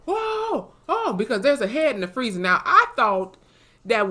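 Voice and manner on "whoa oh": high shrieking screams of shock; screaming. Retold or imitated. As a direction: shriek in sudden horror. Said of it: "she screams".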